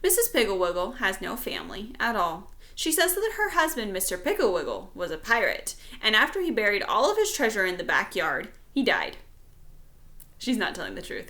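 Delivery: usual speaking voice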